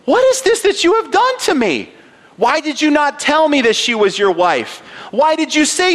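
Pharaoh demanding, pitch jumping high; outraged king confronting Abram; indignant Pharaoh. Acted. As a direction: read it as a king who's been wronged. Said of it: "So Pharaoh called Abram and said".

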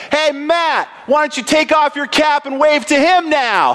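high, laughing call across the field; teen yelling from the dugout to a teammate; taunting shout. Retold or imitated. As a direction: yell it out to mock the pitcher. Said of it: "lean my head out the dugout and say".

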